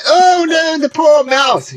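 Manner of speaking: high mocking wail over the mouse; mock pity. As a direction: parody people who whine. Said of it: "People say".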